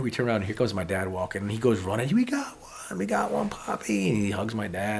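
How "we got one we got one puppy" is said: put-on very high, stretched little-kid cheer; squealy mock-child celebration; excited child. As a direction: imitate a boy shouting the great news. Son runs cheering to grandfather.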